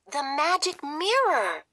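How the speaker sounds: high surprised exclamation; gasp. Acted. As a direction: realize it all at once. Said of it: "gasps Sophia".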